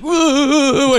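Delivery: high mock gasp of wonder; mock surprise. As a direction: act amazed. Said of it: "he's like".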